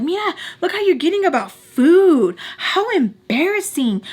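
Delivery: high, sing-song mock of her scolding; mocking imitation. Rival belittling her over food, mocking imitation.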